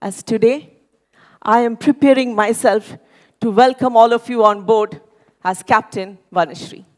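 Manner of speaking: usual speaking voice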